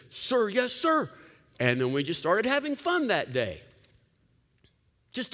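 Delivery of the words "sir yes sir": mock soldier reply. Couple answering King Jesus like soldiers, hammed-up salute. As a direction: snap it back like a recruit to a drill sergeant. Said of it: "And we said".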